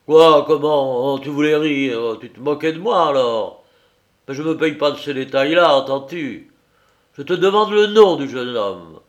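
trembling with anger. A narrator's reading. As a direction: explode at his wife. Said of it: "il frémissait de colère".

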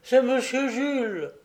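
high-pitched, startled recognition; husband's acted dismay, pitched well up; alarmed exclamation. A narrator's reading. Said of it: "s'écria-t-il avec un mouvement d'effroi".

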